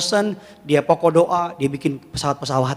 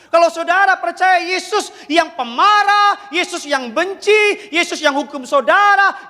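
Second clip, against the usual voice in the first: preaching at full force; 11 semitones higher than usual. Acted. Preacher pressing the congregation, full-force preaching.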